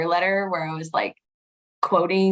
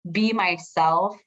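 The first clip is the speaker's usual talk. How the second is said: ordinary talk, nothing marked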